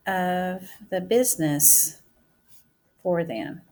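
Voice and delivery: drawn out, word by word as she writes; teacher dictating her sentence while writing; slow dictation. Shown as a demonstration. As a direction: say it at writing speed for the class.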